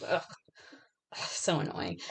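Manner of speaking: ordinary talk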